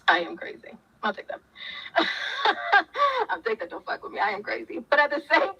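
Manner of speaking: laughing. Real, not acted. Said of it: "I know".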